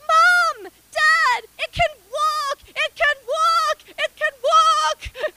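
put-on high, loud excited child shouting to his parents; a neighbour kid yells to mum and dad, high and loud; kid yelling. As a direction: call out like an amazed kid across the yard. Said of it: "yells from his front yard".

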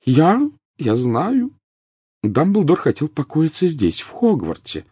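slow, high professor, faltering; hesitate, drawing the words out high. A narrator's reading. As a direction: professor losing her brisk tone. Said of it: "отчасти утратившим прежнюю деловитость тоном".

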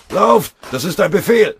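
high, very loud desperate command; shouted order. Acted. Wounded lieutenant ordering his men to run, urgent shout. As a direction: yell it at full force over gunfire.

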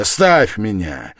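loud, drawn-out desperate plea; anguished cry. A narrator's reading. Man pushing Sonya away, anguished. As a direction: cry it slowly, in convulsive anguish. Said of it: "вскричал он вдруг в судорожной тоске".

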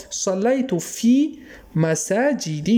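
مساجد very slow, ending in "-i"; kasra ending. Shown as a demonstration. Teacher showing kasra without alif-lam, مساجد ending in "-i". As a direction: draw out مساجد as "masaajidi". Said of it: "برای چی کسره شد؟".

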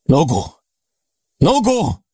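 high-pitched alarmed call, rising sharply on the repeat; acted cry of alarm without full volume; desperate yell. A narrator's reading. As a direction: call out in despair to rouse someone. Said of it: "Ordítok".

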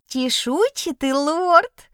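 high, slow giggly kitten squeal; giggling kitten voice. A narrator's reading. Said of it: "захихикал чудик".

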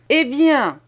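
curt, irritated eh bien; ill-tempered. Acted. Master losing patience with the boy, curt and irritated. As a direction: snap impatiently at the boy. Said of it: "dit Holdnick avec humeur".

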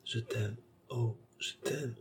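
soft, slow, murmured love words; tender murmur. A narrator's reading. Baccarat cradling her lover's head, softly murmuring. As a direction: breathe it to him, hushed and slow. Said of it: "lui répétait avec enthousiasme".

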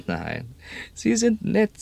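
quoting his late friend in her high, slow voice; imitating old Marianne. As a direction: mimic the dead woman fondly. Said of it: "sagte sie".

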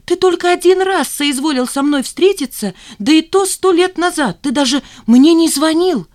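high, loud accusation; indignant outburst. A narrator's reading. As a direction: flare up at an absent father. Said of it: "взвилась Жанна". (возмущённая тирада)

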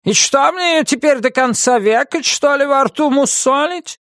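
high, indignant witch voice; cross witch. Acted. Witch grumbles about the rabbit's foot, crossly. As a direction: complain in a high witch's pitch.

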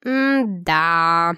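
low, slow drawn-out sigh "м-да". Acted. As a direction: stretch "м-да" out, unimpressed. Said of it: "протянула Тара".

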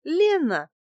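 high, lilting woman's answer; coy fib. A narrator's reading. Woman gives the man a false name, high coy voice. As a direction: offer the name sweetly. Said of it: "соврала я".

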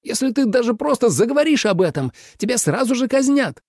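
high, agitated warning; alarmed warning. A narrator's reading. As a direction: warn urgently on a high pitch. Император в тревоге предостерегает. (встревоженно, высоким голосом)

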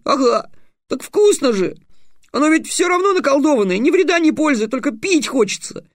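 put-on a cheeky little boy munching sweets; boy's voice.

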